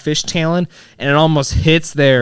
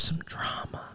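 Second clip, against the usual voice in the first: a sly hushed aside; gossipy whisper; 19 dB quieter than usual. Pretend. Son whispering about hometown drama to his friends. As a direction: whisper it like juicy small-town gossip.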